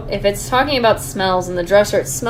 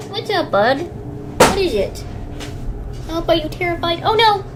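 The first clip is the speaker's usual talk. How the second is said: rising alarmed voice to the dog; anxious, pitch climbing high; scared horror game streamer; 9 semitones higher than usual. Real, not acted.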